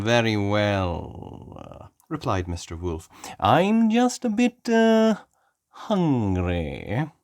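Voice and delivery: slow sly drawn-out wolf; a wolf hinting at his appetite; Mister Wolf voice. Acted. Mister Wolf answers Daddy Pig, slow sly voice.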